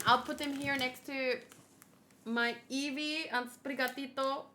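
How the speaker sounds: normal talking voice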